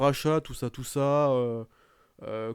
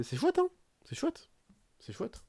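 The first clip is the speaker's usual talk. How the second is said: sarcastic; 11 semitones higher, 6 dB quieter than usual. Real, not acted. Sarcastic praise of the company.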